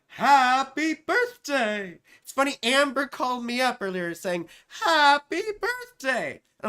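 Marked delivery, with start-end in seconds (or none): 0.1-2.0 loud, high, drawn-out cartoon birthday cheer; Frosty the Snowman. Host greeting a client, loud cartoon cheer. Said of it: "it's like Frosty the Snowman". 4.8-6.4 very high, loud, stretched-out cartoon greeting; Frosty the Snowman. Client calling up as a cartoon, high loud cheer. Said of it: "it's like Frosty the Snowman".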